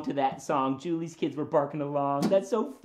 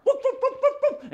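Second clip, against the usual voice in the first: rapid yapping, high-pitched; high dog barks; 6 dB louder than usual. Pretend. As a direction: bark at a passing stranger. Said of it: "I just wanna bark".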